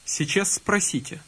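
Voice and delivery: ordinary delivery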